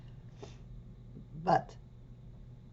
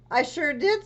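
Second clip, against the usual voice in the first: cheerful high chirp; 10 semitones higher, 4 dB louder than usual. Acted. Amelia voiced cheerfully.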